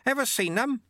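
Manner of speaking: high-pitched call over the wind; shouted. A narrator's reading. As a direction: call out high, to carry over the rushing air. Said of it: "Lutze shouted".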